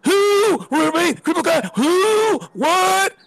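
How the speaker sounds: high, crying shouted squeaks; hammed-up crying falsetto shout; mocking shrieks. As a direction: shriek like a mocked crybaby. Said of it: "They had on".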